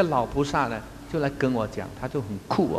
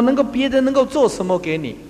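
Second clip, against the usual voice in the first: 高声强调; 9 semitones higher, 5 dB louder than usual. Real, not acted. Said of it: "因为".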